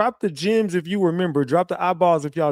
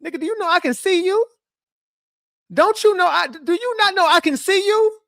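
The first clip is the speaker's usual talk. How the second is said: much higher, mock-incredulous squeak; 13 semitones higher, 3 dB louder than usual. Teasing Thello about being on the tapes, in a high mock squeak. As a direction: squeal it like a caught-out gotcha.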